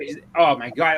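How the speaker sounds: ordinary talk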